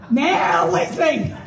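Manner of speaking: "Now leasing" shouted slow and drawn out through a laugh; mocking shout. Shown as a demonstration. Speaker mocking the Now leasing sign, laughing and shouting.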